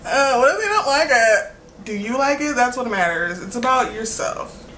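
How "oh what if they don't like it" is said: mock worried voice. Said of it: "to be like".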